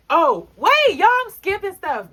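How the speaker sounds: high, loud "oh wait" as she catches herself; storyteller interrupting herself to her viewers, loud surprise; sudden realization. Real, not acted. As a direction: break off the story in real surprise.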